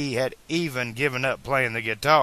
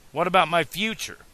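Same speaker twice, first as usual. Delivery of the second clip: higher-pitched, urgent question; interrupting; 6 semitones higher, faster than usual. A narrator's reading. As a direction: jump in wanting an answer. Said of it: "Marty interrupted".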